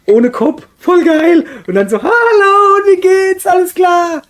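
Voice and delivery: high, loud, thrilled rant; excited shouting. Real, not acted. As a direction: shout it out with glee. Excited rant about headless figure.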